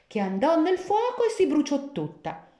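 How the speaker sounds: plain reading voice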